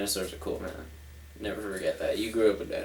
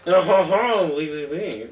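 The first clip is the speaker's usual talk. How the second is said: mock French; slower than usual. French character answers in kind.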